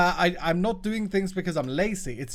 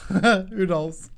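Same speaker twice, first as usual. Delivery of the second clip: repeated with a laugh in it, higher and louder; 3 semitones higher, 5 dB louder than usual. Shown as a demonstration. Streamer joking about a game bug, laughing. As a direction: laugh it through as a joke.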